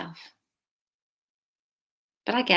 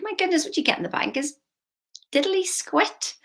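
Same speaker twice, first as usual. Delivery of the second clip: mocking scorn; 9 semitones higher than usual. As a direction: stretch diddly squat out in mock disdain. Mocking bank interest.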